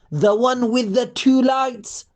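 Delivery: ordinary delivery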